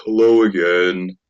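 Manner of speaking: slow, lightly voiced big young man; big lad greeting. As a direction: drawl it like a gentle giant. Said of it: "he kinda just says".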